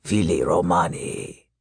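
deep growled villain voice; low snarling wolfman. Acted. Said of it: "The Wolfman snarled".

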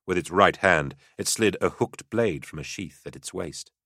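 usual speaking voice

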